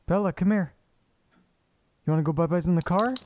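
excited doggy voice offering a car ride; cooing to the dog. Real, not acted. Talking sweetly to the dog.

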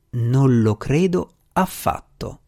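slow, every word pronounced clearly; spelling out each word. A narrator's reading. Sir Geoffrey to the deputy minister, slow and each word spelled out. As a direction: lay each word down deliberately. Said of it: "scandendo bene le parole".

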